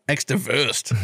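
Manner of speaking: "Extrawurst" said in a mock German accent; put-on German accent on "Extrawurst"; German pronunciation. Shown as a demonstration. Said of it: "You gotta do it right, Jared".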